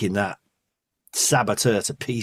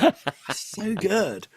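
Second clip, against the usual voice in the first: laughing; 9 semitones higher, much slower than usual. Real, not acted. Laughing while praising the mask.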